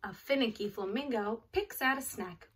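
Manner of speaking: ordinary talk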